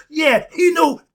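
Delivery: can't hold back the enthusiasm; excited. Real, not acted. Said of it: "I'm getting excited".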